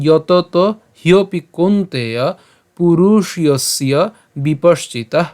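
slow Sanskrit recitation; measured teacher's model reading; verse line demo. Shown as a demonstration. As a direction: recite carefully, stretching long vowels. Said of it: "আবার প্রথম লাইনটা বলি".

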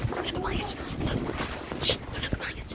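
hushed whisper under blankets; whisper, trying not to be found; whispering while hiding. Real, not acted.

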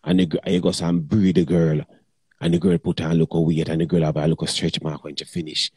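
usual speaking voice

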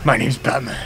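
low, gravelly-sounding hero growl; Batman voice. Pretend. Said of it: "should I try and do Batman".

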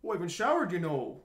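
light Minnesotan lilt; Minnesota accent. Shown as a demonstration. Showing friend how they talk, light Minnesota accent. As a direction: try a mild Minnesota accent. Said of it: "Minnesota. ... They say, like".

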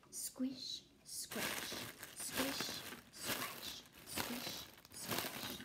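breathy whispered rhythm; whispered swish swash. Acted. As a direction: whisper the grass noises softly. Whispered swamp sounds.

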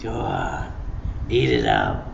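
mock a spoiling parent cooing; indulgent parent. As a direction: parent giving in to a sulking child, doting. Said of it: "and they go".

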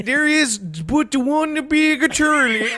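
high, slow lightly Canadian narrator; a mild hammed-up Canadian voice; put-on Canadian accent. Voicing a game character in a mild Canadian accent. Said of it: "I love doing this voice".

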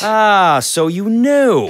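high, loud drawn-out so you know; secretary nails the clerk on the rule; triumphant gotcha. Acted. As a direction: savour catching him out.

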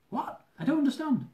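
high, baffled protest; bewildered. Acted. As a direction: plead in confusion. Confused at ticket official.

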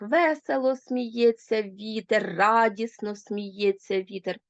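Весело and радісно slow and stressed; stress on adverb. Shown as a demonstration. Teacher showing the adverb swapped into the sentence, slow. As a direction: lean slowly on Весело and радісно. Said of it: "Улавлюєте різницю?".

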